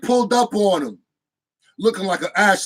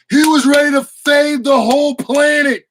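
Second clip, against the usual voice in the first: shouting with excitement; loud hype; 7 semitones higher, 5 dB louder than usual. Real, not acted. Hyping up friends about the battle, shouting. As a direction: yell it out, hyped. Hyped retelling.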